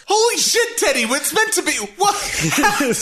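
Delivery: high, startled cry of disbelief; shocked outburst. Real, not acted. A player shocked at what just happened, crying out high. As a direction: blurt it out in shock.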